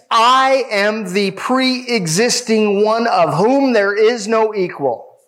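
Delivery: I am the pre-existing one, slowly; slow, deliberate. Shown as a demonstration. Pastor spelling out the Greek meaning, slow and deliberate. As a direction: weigh each word of the definition. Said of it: "it means".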